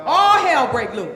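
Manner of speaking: very loud chanted line. Acted. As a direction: shout it so the room echoes. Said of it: "say it with me".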